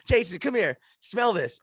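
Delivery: hammed-up dad summoning his son; dad calling. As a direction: call the kid over like an excited dad.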